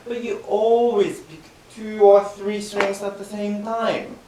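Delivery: ordinary delivery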